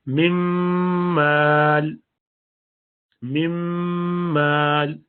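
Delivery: مم مال with a long held nasal mim; full merge with ghunna. Shown as a demonstration. Teacher demonstrating full idgham: slow nasal mim. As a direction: hum the doubled mim very slowly. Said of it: "میم میں ایسا مکمل ادغام".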